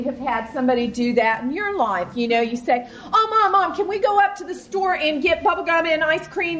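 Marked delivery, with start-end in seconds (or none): 3.1-6.6 put-on begging kid. Child begging mom voiced.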